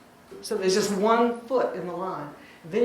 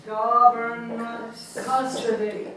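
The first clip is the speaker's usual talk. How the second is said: slow GOVernment CUStody; dactyl stress; slower than usual. Shown as a demonstration. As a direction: stretch the first syllable of government and of custody. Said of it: "like stressed, unstressed, unstressed".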